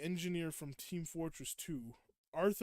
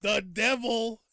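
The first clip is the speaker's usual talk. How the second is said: loud, high devil meme voice; hammed-up demon, punched and raised; comic devil; 6 semitones higher, 10 dB louder, slower than usual. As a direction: announce it grandly as a silly devil. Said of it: "the voice for it is so funny".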